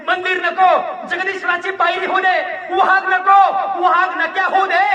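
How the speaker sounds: loud declamation climbing to a high shout; impassioned plea. Acted. Orator begging God for his next birth, rising fervent shout.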